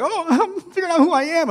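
high, laughing, giddy voice; giddy excitement. Retold or imitated. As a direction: squeal it like a kid unwrapping a gift. Retelling thrill at test results.

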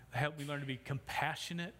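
ordinary talk

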